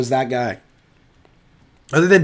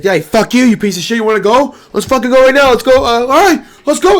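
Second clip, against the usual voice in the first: higher and louder, squaring up to fight; mock angry challenge; 7 semitones higher, 4 dB louder than usual. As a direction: bark it like a fighter calling him out. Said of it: "He's like".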